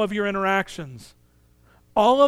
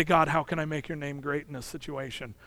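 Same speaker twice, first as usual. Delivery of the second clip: softer, lower aside to God; quiet prayer; 6 semitones lower, 9 dB quieter than usual. Acted. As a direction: drop into a hushed, humble prayer.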